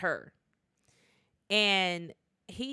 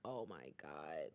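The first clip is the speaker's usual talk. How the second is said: much softer, lower "oh my God"; hushed dismay; 15 dB quieter than usual. Real, not acted. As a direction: breathe it out in quiet despair. Reacting to Claudia crying.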